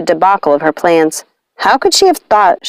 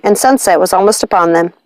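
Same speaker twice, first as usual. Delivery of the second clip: ordinary delivery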